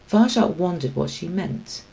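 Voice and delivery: usual speaking voice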